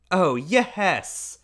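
put-on high bright child voice; cheerful boy. A narrator's reading. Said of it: "and his eyes smiled".

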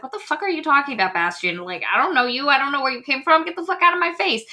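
fast and rising, mock indignant rant; a hammed-up angry brush-off; mock outraged. Gwen snapping at Bastian, mock indignant rant. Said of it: "she's like".

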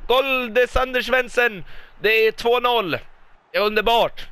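high, ringing call easing down from the peak; elated announcement. Real, not acted. Commentator announces Svensson's two-nil goal, elated. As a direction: proclaim the scorer, still elated.

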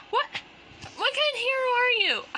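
high, loud indignant protest; high loud huffy protest. Acted. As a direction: scold the so-called hero. Girl indignant at rescuer.

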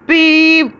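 one long, high, loud beep; train whistle beep. A narrator's reading. Edward signals at the station, whistling. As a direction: imitate an engine's whistle. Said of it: "whistled Edward".

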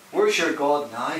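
ordinary talk

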